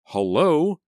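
high, loud yelled hello. A narrator's reading. Selena interrupted by the phone, yelling. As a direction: yell it into the receiver, irritated. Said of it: "she yelled into the receiver".

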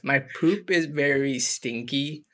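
slow, dramatic poem read. Acted. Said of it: "let me read it all at once".

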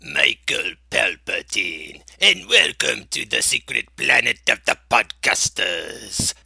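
a villain savouring his own name; Palpatine voice. Acted. Host introduces himself as Palpatine.

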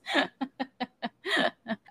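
shaky nervous laughter. Real, not acted. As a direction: laugh it off uneasily. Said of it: "my nervous laughter thing".

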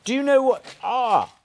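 high, loud hello to newcomers; calling out hello as guests walk in; excited greeting. Real, not acted. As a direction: greet them with a bright, loud burst. Spotting new guests arriving.

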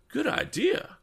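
very high, eager exclamation; high-pitched burst of approval; excited cry. A narrator's reading. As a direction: pipe up high with delight. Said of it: "cried Mister Damon".

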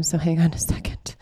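whispered aside while searching; whispering. Real, not acted. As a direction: mutter it under your breath. Searching for the questions.

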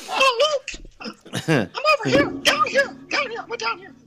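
very high goofy cartoon voice; Goofy as Darth Vader. Shown as a demonstration. Goofy doing Darth Vader to Luke, very high cartoon voice. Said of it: "Goofy as, uh, as Darth Vader".

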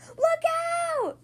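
high, loud warning yell. Acted. Splat warning his dad of a flying egg, loud yell. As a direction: shout the warning sharply. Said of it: "yelled Splat".

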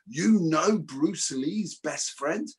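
incredulous question. Retold or imitated. Others question him about knowing Bruce Lee's friend, incredulous. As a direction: stretch it out as if amazed at the name-drop. Acting out others' amazed reaction.

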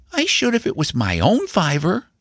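put-on high child's voice; small boy insisting, pitched high; high-voiced determined nephew; earnest young boy. A narrator's reading.

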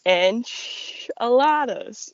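stretched out slowly, savoring it; slow drawn-out repeat. Acted. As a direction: drag out the punchline.

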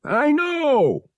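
high-pitched exasperated yell. A narrator's reading. Pilot answering the captain unheard, yelling. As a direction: yell back at the comm unit. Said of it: "Han yelled".

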